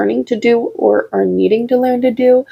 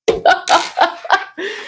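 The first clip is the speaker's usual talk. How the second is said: high giddy laugh; 8 semitones higher than usual. Real, not acted. Reacting to spirit sensation.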